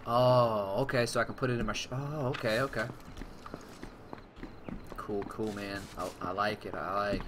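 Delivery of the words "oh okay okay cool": normal talking voice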